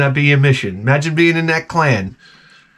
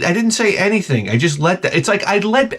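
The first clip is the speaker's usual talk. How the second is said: usual speaking voice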